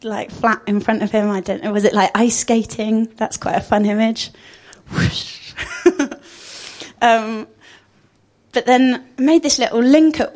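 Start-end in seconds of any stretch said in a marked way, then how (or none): 4.9-5.5 whoosh sound. Pretend. Pictures Jesus ice skating.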